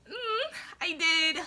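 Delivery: near tears. Retold or imitated. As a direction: say it like you're about to cry. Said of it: "I was about to cry".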